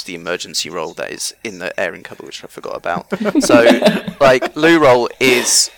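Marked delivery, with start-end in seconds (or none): 3.1-5.7 laughing through the words, slowing right down. Real, not acted. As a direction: giggle it out, drawn out and louder. Laughing while explaining shortage.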